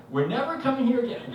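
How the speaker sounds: high, loud imitated protest; mock-hysterical vow, far above own pitch; panicked insistence. Wife insisting to husband, hysterical. Upset wife repeats her refusal.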